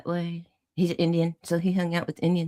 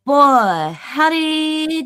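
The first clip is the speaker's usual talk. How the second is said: Southern exclamation; 7 semitones higher, 5 dB louder, much slower than usual. Retold or imitated. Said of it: "just to hear me talk".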